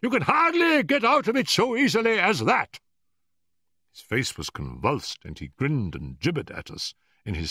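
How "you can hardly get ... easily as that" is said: very high, screaming rage; loud, shrieking old man beside himself; furious passion. A narrator's reading. Professor blocks the visitors' way out, screaming furiously. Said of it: "with furious passion".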